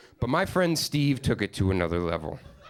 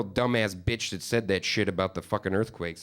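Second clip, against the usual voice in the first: ordinary talk, nothing marked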